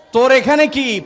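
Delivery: "তোর এখানে কি?" yelled loud and high; scolding shout. Retold or imitated. Grandmother chasing the fast-breaker off the table, shouting "তোর এখানে কি?". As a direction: bark "তোর এখানে কি?" at the child. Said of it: "দাদি এক ধমক দেয়".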